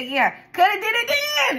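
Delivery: high, loud mock urging; imitated excited cousin. Retells cousin's excited pitch.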